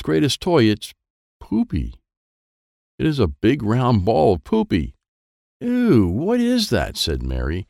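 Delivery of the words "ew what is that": put-on high, slow disgusted little girl. A narrator's reading. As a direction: squeal it out like a grossed-out girl. Mary voiced in read story.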